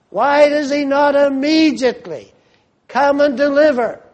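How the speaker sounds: high, loud demand; fervent preaching climax. Real, not acted. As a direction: press the question hard. Preacher voicing the church's complaint.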